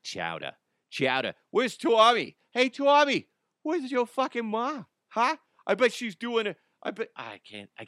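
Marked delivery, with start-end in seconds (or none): 0.0-1.3 imitating a New England accent; a hint of Boston accent on chowder. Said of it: "do his New England accent". 1.5-7.1 put-on high shouted New England accent; loud, high mock New England tough guy. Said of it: "his New England accent".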